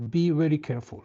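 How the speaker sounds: ordinary talk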